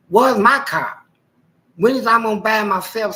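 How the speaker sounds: loud, high, fed-up complaint; angry rant. Retold or imitated. Ranting at his wife about the car, loud and fed up. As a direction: raise it, demanding.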